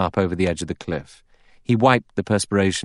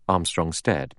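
usual speaking voice

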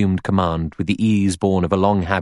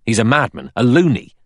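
hoarse; 5 semitones higher, 6 dB louder than usual. A narrator's reading. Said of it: "Blore said hoarsely".

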